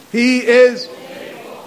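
slow shared declaration; slow call and response. Shown as a demonstration. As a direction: lead them word by word. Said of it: "Say it with me".

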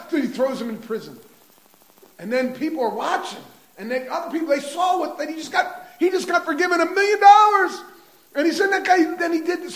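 high, loud, quickening telling; preaching at full force. Acted. The preacher telling how onlookers saw the injustice, high and loud. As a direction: pile on the outrage for the listeners.

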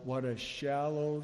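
ordinary talk

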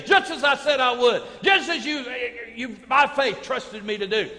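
loud, high, impassioned preacher; full-force preaching. Real, not acted. Preacher telling congregation what God promised Hezekiah, full-force preaching. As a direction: build to a shouted sermon climax.

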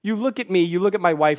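ordinary talk

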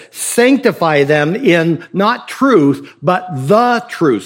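slow, with "the" pressed hard before "truth"; stress on the. Shown as a demonstration. Preacher defining truth for the congregation, slow stress on "the". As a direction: lean on "the" in "but the truth", slowly. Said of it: "So he now defines this".

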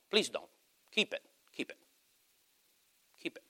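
soft aside, fading almost to nothing; hushed plea. Real, not acted. Preacher telling reluctant givers to keep their money, hushed. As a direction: drop away to a barely voiced plea.

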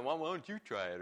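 put-on snarky teen backtalk. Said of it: "a little bit of snarky remark".

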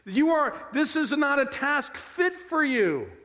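high, emphatic objection; protesting Peter. Acted. Peter telling Jesus the task is beneath him, protesting. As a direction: insist slowly, pitched high, that it is unworthy. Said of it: "The implication of Peter is".

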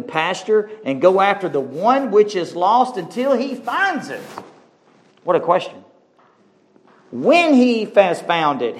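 lifted, swooping preacher's reading; impassioned scripture preaching. Acted. Preacher reads the lost sheep parable aloud, impassioned preaching.

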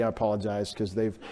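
ordinary delivery

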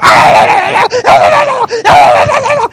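frantic high-pitched yelps, loud; imitated dog yelping. Preacher mimicking his dog on the shock collar, frantic yelping. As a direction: yelp rapidly like a dog being zapped. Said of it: "she's writhing on the couch going".

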